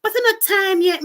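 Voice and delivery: high, loud driver voice. Retold or imitated. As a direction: voice the surprised driver. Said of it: "Driver say".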